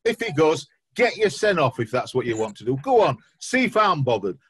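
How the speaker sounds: hammed-up huff at the departing manager; mock indignant rant. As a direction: pretend scorned fan, loud and petulant. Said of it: "is that better".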